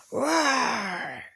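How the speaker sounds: playful pretend roar; hammed-up little roar; mock roar. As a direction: roar to play scary for a child. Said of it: "And I can be scary too".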